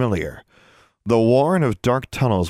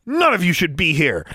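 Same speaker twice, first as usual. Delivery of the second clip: sharp, higher-pitched angry retort; snapped; 7 semitones higher than usual. A narrator's reading. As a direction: snap it back at them. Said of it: "Alexandra snapped".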